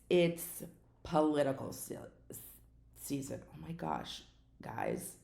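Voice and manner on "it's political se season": halting stumble over the word; tongue-tied. Real, not acted. Podcaster stumbling mid-sentence, tongue-tied. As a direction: trip on the word, pause, then finish it. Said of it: "I'm super tongue-tied today".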